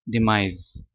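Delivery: डिमाइस slow, ending in z; demise with a z. Shown as a demonstration. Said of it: "यहाँ पे जेड आ जाएगा".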